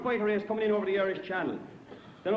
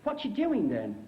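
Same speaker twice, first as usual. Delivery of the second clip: put-on English accent; 4 semitones higher, slower than usual. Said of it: "this English fella goes by".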